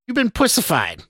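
very high, derisive jab; jeering put-down; scoff. A narrator's reading. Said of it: "the stranger scoffed".

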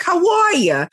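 a Nigerian dad with a Brooklyn twang; Brooklyn-African accent. Retold or imitated. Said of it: "a weird combination of Brooklyn and African".

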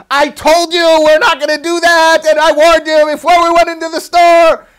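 high, loud furious scolding; angry mom yelling. Retold or imitated. Angry mother scolding her child in the car, shouting. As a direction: mom snapping at her kid in the back seat. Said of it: "she starts yelling at the kid".

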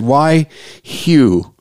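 high, anguished plea, drawn out; retold yell at God. Grieving friend questioning God about his loss, mild anguished yell. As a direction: retell a pained cry, falling at the end. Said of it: "I yelled at God pretty good".

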